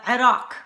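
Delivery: Iraq as eh-RAHK with a tapped r; Arabic pronunciation. Shown as a demonstration. Cook starting the countries, Arabic pronunciation. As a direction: say Iraq with throaty opening vowel. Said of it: "it is Iraq".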